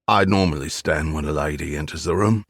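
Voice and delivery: put-on old East End Cockney voice; gruff old Londoner, Cockney; Cockney accent. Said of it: "with an East End Cockney accent".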